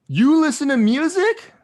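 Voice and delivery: high, slow, stunned voice; incredulous high schooler. Retold or imitated. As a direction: act like a shocked teen. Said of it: "some people were floored to see Cece being like".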